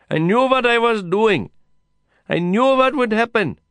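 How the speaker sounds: high, loud insistent confession. A narrator's reading. Kasim insists on his punishment to Lin, loud. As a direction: drive each line home at full voice. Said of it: "louder than before".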